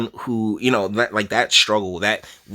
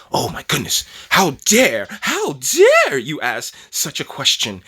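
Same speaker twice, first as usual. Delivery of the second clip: mock-indignant outburst, louder on "how dare"; self-righteous indignation; 3 semitones higher, 3 dB louder than usual. Everyone getting all self-righteous over a simple question, mock indignant. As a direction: play up offended outrage, drawing out the last words. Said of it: "get all self-righteous and indignant".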